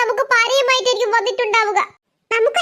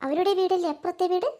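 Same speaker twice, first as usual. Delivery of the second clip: much softer, hushed voices; hushed sneaking; 9 dB quieter than usual. Acted. Sisters sneaking up to spy, speaking hushed. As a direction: keep it down so they won't hear.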